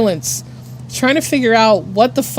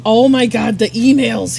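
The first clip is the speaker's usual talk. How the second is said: acted sudden realisation; 6 dB louder than usual. Acts out sudden realisation about boss's request.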